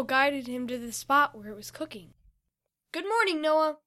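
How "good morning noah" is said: higher, louder good-morning greeting; upbeat morning hello; cheery grin. A narrator's reading. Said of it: "Fido said with a grin".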